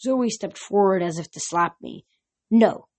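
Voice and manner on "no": firm command; firm order. A narrator's reading. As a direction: stop her with one word. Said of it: "the other girl ordered".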